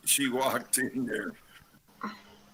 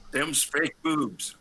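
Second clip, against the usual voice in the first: slow drawled Southern twang on fake boobs; Southern accent; 4 semitones higher, 3 dB louder, much slower than usual. Shown as a demonstration. Friend shows the host the line, in a slow Southern accent. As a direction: lay on a thick Southern accent, slowly. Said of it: "in your best Southern accent".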